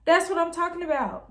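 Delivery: high, excited cheer; cheering her on. Retold or imitated. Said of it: "I was like".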